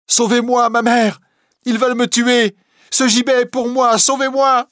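very high, loud cries for help; terrified screaming. Acted. Girl dragged to the gallows, screaming to mother. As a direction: scream desperately for your life.

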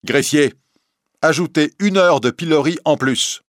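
high, loud order; stern provost. A narrator's reading. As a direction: order the clerk sharply. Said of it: "Le prévôt se retourne". (le prévôt ordonne au greffier, haut et fort)

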